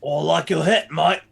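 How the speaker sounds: lively Australian "hat" as "het", "mate" as "might"; Australian accent. Shown as a demonstration. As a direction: call it out in broad Australian. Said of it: "is basically Australian".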